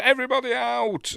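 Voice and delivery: high-pitched mock yell; acted shout. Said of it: "Walter comes in and shouts at him".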